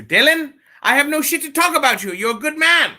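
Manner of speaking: high, loud mock praise; hammed-up loud praise for Dylan; mock heartfelt. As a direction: gush at him, high and loud. Playful reassurance shouted to Dylan.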